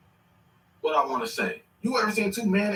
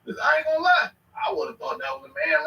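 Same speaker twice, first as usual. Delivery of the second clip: ordinary talk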